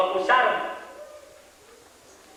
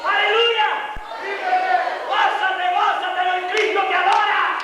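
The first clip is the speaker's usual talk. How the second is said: high, driving pulpit shout; impassioned high-pitched sermon cry; full-force preaching; 12 semitones higher, slower than usual. Real, not acted. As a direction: drive each phrase home at a shout.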